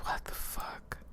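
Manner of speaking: shocked whisper under breath. Real, not acted. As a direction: breathe it out in disbelief. Shocked reaction to puzzle.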